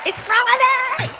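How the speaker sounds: high, loud hype cheer; excited shout. Real, not acted. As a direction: pump up the crowd. Hyping up the stream audience.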